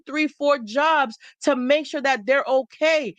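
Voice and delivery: ordinary delivery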